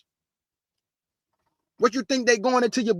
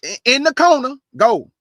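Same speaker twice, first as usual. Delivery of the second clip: louder, higher scolding order; scolding shout; 5 semitones higher, 7 dB louder than usual. Real, not acted. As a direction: banish them like a stern parent. Sends critics to the corner.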